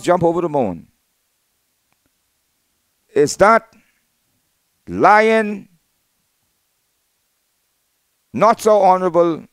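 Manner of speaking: loud, high-pitched public denunciation; scornful denunciation. Real, not acted. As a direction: call out a political foe with ringing scorn. Denouncing a political opponent on air.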